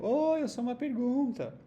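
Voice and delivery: high, loud cheerful self-introduction; the auxiliary do introduces itself, high and loud; cartoon hero greeting. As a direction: announce yourself like an eager anime hero. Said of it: "pra dizer assim, ó".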